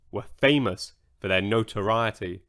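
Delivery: plain reading voice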